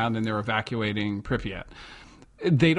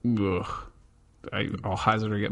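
ordinary talk

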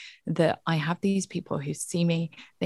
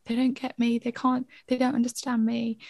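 put-on inner child; 6 semitones higher than usual. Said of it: "that little child in us that is going".

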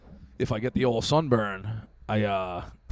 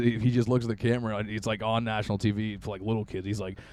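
ordinary talk, nothing marked

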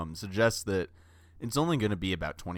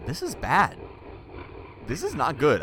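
alarmed; 8 semitones higher, 5 dB louder than usual. Pretend.

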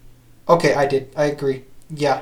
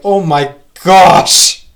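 drawn-out, much louder stunned "oh my gosh"; shocked; 10 dB louder, much slower than usual. Retold or imitated. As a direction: act floored by the news, slowly. Retelling his reaction to tweet.

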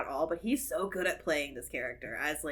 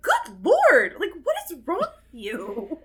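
loud, high mock outrage; appalled outburst; 8 semitones higher, 9 dB louder, slower than usual. Viewer scolding an obscene caller, appalled outburst. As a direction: exclaim it aghast, breaking into a laugh.

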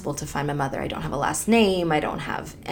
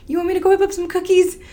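much higher, fast mock husband; husband's offer; 14 semitones higher, 5 dB louder, faster than usual. Husband eagerly offering to whip up cookies, high and fast. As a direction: squeak it out like an eager helper. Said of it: "And so he's like".

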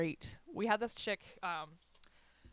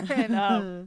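loud laughter spilling into the words; laughing; 5 semitones higher, 12 dB louder than usual. Real, not acted. Laughing at her own story to her friend. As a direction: laugh through it.

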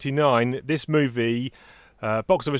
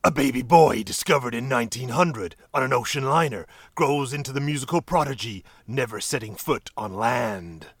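dramatic movie trailer narrator. Shown as a demonstration. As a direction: read it like a trailer voiceover. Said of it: "I'll do it in a movie voice".

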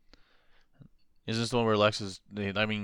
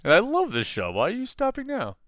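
much higher, whining complaint; mock whiny fan voice; 11 semitones higher, 5 dB louder than usual. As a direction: whine like a hurt fan. Mock-quoting Gary the artist.

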